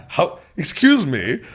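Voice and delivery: high, loud, slow indignation; mock raging. Young pianist's ego affronted by teacher, mock raging. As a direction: stretch it out in hammed-up affront. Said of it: "And I'm raging".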